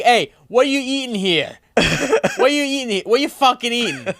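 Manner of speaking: put-on loud, high Brooklyn tough-guy accent. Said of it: "I talk with, like, a Brooklyn accent".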